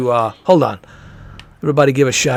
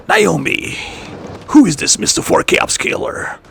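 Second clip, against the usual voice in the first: Snake voice; 6 semitones higher than usual. Retold or imitated. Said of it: "he plays as a snake".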